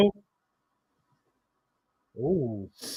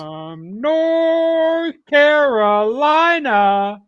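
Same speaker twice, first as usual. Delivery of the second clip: ring announcer; 8 semitones higher, 3 dB louder, much slower than usual. Retold or imitated.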